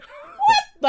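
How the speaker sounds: very high, loud double take; mock astonishment. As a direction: exclaim in hammed-up disbelief. Acts startled reaction to mum's posh phrases.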